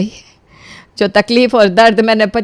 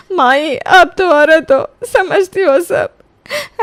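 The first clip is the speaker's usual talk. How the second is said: slow, crying plea to the mother-in-law; tearful plea; 7 semitones higher, much slower than usual. Acted. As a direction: beg her through tears, dragging the words. बहू रोते हुए विनती करती. (रोते हुए धीमी विनती)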